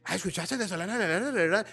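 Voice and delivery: usual speaking voice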